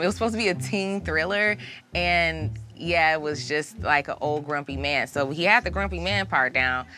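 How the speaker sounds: usual speaking voice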